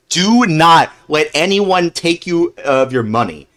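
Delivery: ordinary talk, nothing marked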